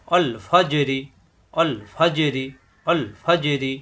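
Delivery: অল ফাজেরি with smooth ল and bounced জ; qalqalah bounce. Shown as a demonstration. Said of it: "এখানে বাড়ি খাবে".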